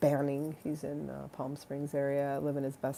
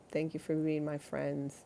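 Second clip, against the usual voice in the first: usual speaking voice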